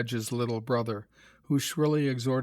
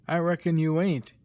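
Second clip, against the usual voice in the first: heavy sarcasm; 4 semitones higher, 4 dB louder than usual. A narrator's reading. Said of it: "retorted Peter with heavy sarcasm".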